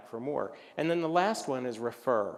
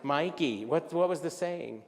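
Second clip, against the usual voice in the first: ordinary talk, nothing marked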